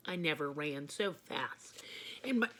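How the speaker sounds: breathy hushed aside; whisper. Acted.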